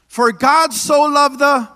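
slow, loud prompt for the crowd; leading a call. Shown as a demonstration. Preacher prompting congregation to recite, slow and loud. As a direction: lead them in, slowly. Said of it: "Can y'all say this?".

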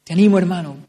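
loud, pleading call to the congregation; a preacher's loud plea to listen; urgent exhortation. Real, not acted. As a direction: burst out to the brother in the pews.

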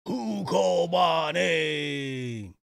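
slow booming dragon call. Retold or imitated. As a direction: boom it out like a summoned dragon. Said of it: "He's gonna say".

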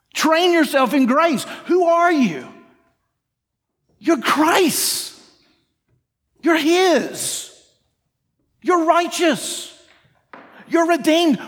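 high, punchy preaching with spaced phrases; emphatic declaration. Acted. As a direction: drive each truth home with conviction. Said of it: "Do you feel the difference of that?".

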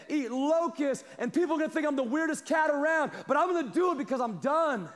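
climbing pulpit fervour; full-force preaching. Acted. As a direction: preach it out to the room.

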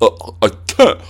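stammering, put-upon mock outrage; mocking offended voice. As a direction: mimic someone playing shocked. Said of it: "you're like".

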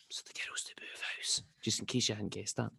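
very soft, near-whispered aside; quick confidential whisper to the listeners; hushed aside. Real, not acted. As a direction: drop right down to explain on the side. Aside explaining the story.

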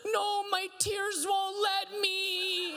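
put-on high, slow, drawn-out child's wail; high weepy child pleading with dad; crying four-year-old. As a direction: stretch it out like a sobbing little boy. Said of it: "He goes".

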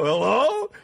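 high, drawn-out questioning hello; acted clueless pickup; tentative phone greeting. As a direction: answer as if unsure who is there. Drunk, answering a mystery phone call.

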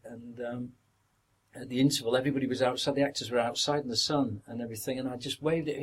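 normal talking voice

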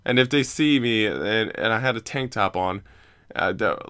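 usual speaking voice

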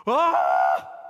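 sudden loud cry of wonder; overwhelmed exclamation of praise; awed cry. Shown as a demonstration. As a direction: burst out in amazement. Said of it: "This is like Paul".

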